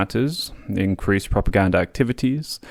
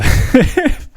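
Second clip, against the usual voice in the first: 1 laughing; 5 dB louder than usual. Real, not acted. Laughs at colonial wording.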